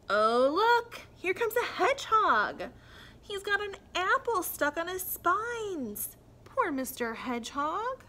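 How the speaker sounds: lively storybook voice with figures; reading to children. A narrator's reading. Teacher reads a picture book to young children, lively. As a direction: read it bright for little ones.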